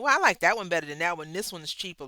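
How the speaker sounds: quick, loud mock busybody chiming in; nosy shopper. Stranger in the grocery store offering unwanted advice, quick and loud. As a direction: play the pushy stranger butting in. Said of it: "they be like".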